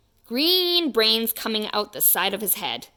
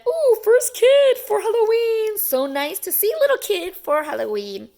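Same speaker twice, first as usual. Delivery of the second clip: much higher, louder put-on voice; big man greeting; 12 semitones higher, 7 dB louder than usual. Big man at the door gushing over the first trick-or-treater. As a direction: gush over the first kid. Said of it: "A big man opened the door and said".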